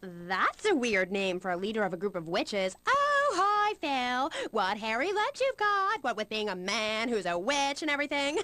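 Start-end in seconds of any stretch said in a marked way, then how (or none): 2.8-6.0 high, sing-song mock greeting; gushing fake hello, pitched way up. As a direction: tease in an exaggerated high voice. Mock greeting to absent cult leader.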